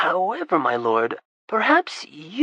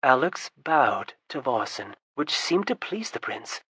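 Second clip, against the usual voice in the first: usual speaking voice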